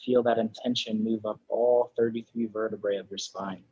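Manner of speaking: soft, unhurried meditation voice with long pauses; guided meditation. Real, not acted. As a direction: ease them gently into final rest, softly. Closing guided relaxation in yoga class.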